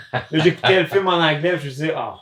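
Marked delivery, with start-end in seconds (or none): none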